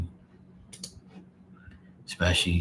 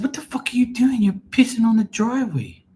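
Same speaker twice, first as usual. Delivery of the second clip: much higher, louder shriek at the dog; shouted at dog; 4 dB louder than usual. Retold or imitated. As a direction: yell at the dog, caught red-handed. Yells at dog peeing.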